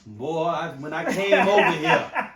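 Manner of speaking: hammed-up slow preacher cadence; old school preacher. Imagined pastor dad confronting the man, mock preacher voice. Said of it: "old school preacher".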